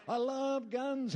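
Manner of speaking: slow, high, hammed-up I love guns; hammed-up gun lover. Preacher mocking his old rough self, slow hammed-up boast. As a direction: relish it like a brash gun nut.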